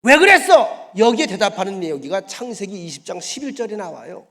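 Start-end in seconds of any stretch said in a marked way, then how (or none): 0.0-0.9 loud high accusing; loud high angry demand. Acted. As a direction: shout the question. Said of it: "그 이야기".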